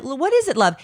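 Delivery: a hint of a Brooklyn mom; Brooklyn-ish mom accent. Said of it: "some accent that's like a Brooklyn accent".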